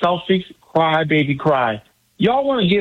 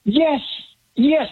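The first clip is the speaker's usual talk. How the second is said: excited yes; 9 semitones higher than usual. Real, not acted. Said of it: "you can't fake the excitement that you got in your voice".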